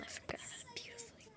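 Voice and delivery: high, fast silly whisper. Pretend. Cat whispering a silly excuse to Madison.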